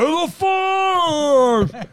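high, loud mock roar; angry giant shout. Retelling the giant spotting the camera, loud furious roar. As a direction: bellow it in mock rage. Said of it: "he looks over again".

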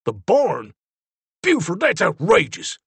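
heated, indignant protest; hotly. A narrator's reading. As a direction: push back hard, outraged. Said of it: "Zeke said hotly".

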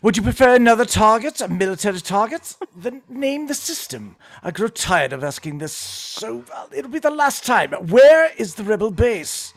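British commander losing patience; British accent. Acted. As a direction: menace a captive in measured British tones. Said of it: "you can do a British accent".

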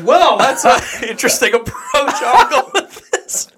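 high-pitched, laughing through the words; laughing disbelief. Retold or imitated. Teller mocks his reaction to friend's bold answer, laughing.

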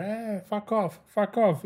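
high-pitched retold yell of fuck off; mimicking a woman screaming fuck off. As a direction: screech it at him like a furious beggar. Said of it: "me empieza a gritar".

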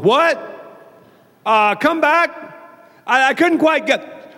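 high, straining-to-hear what; puzzled listener. Acted. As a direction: call out as if you missed it. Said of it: "people are always going".

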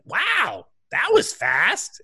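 put-on high, slow, impressed old lady; grandma voice. Grandma reacts to the first goal, high and slow. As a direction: marvel slowly like a grandma. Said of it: "she's just like".

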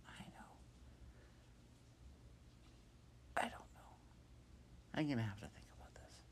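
hushed whisper to herself; whisper, thinking it over. Acted. Hop whispering to herself while thinking.

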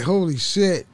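normal talking voice

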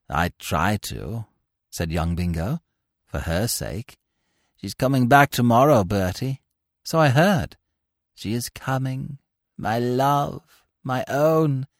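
lightly voiced long-suffering suitor; weary lovesick Bingo. A narrator's reading. Bingo voiced throughout scene.

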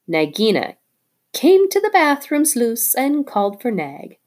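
high chirpy bird voice, bragging; a tailorbird crowing over the dead snake; excited little bird. Acted. Darzee the bird talking.

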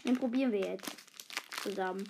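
normal talking voice

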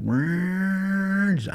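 high, drawn-out siren wail; imitated alarm wail. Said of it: "the general alarm went off".

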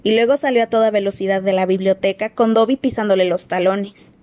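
usual speaking voice

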